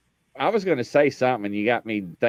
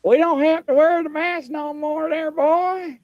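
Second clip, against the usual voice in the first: imitating the lady. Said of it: "the lady was like".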